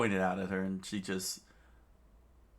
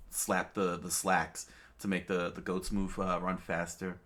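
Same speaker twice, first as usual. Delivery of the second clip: ordinary delivery, nothing marked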